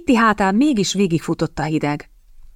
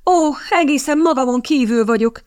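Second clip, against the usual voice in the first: high, agitated cry; exclaiming; 7 semitones higher than usual. A narrator's reading. Kitty announces she is beside herself, exclaiming. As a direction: cry out in a raised pitch. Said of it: "kiáltotta Kitty".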